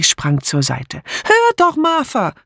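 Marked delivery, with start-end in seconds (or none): 1.2-2.3 very high-pitched distant shout; urgent calling out. A narrator's reading. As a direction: shout desperately after someone who is leaving. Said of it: "rief sie". (laut und hoch hinterhergerufen)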